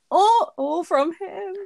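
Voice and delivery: high, slow gushing aww. Real, not acted. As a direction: melt over how cute it is. Gushing over the flowers.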